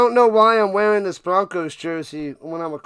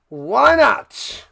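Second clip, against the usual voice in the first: why not called out louder and higher; loud repeat; 3 semitones higher, 5 dB louder than usual. Shown as a demonstration.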